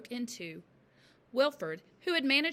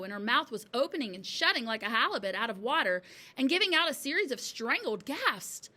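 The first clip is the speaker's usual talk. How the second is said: usual speaking voice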